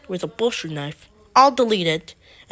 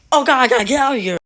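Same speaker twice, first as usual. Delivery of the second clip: rapid, loud, raised panic; acted panic; 4 semitones higher, 8 dB louder, much faster than usual. Josiah runs from George, acted panic. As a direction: blurt it fast in mock panic.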